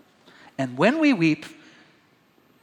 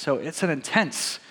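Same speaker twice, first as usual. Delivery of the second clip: usual speaking voice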